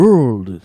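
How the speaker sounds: very loud, isolated "World"; pronunciation drill. Shown as a demonstration. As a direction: punch out "World" with curled r and l. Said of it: "特訓しましょう".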